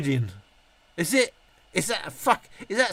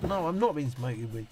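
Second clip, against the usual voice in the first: ordinary talk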